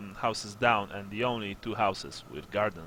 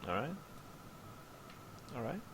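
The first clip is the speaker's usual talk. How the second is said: ordinary talk, nothing marked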